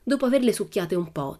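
usual speaking voice

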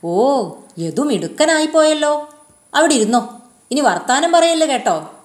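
put-on teacher grudgingly praising the boy; hiding disappointment. A narrator's reading. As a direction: mask the letdown with mock approval. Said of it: "നിരാശ ... പുറത്തു കാണിക്കാതെ".

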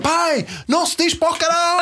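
put-on high little girl correcting her dad; scolding toddler. Daughter runs in to correct father, high child voice. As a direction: tell dad off like a tiny know-it-all. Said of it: "vem ela a correr do quarto".

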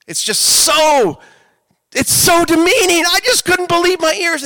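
high, loud, outraged outburst; loud indignation. Real, not acted. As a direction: cry out in disbelief at something demeaning. Indignant outburst about the app.

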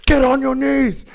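high, loud barked command; yelling. Retold or imitated. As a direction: yell it as an urgent order. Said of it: "The guy's yelling at me".

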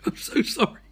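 breathless whisper; whispered apology. Acted. Host apologising to his loved ones, whispered. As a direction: whisper it like a last goodbye.

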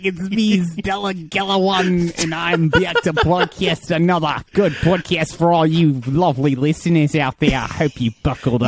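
put-on accent sliding from Scottish through Irish to Australian; hammed-up podcast intro in a muddled Scottish-Irish-Australian accent; wandering accent. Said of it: "Scottish to Irish to Australian".

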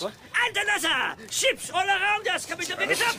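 high, fast jeering shout; frenzied shouting that breaks into laughter; manic mockery. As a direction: race through it in wild mock glee. Raving mockery of rescue hopes.

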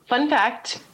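ordinary delivery

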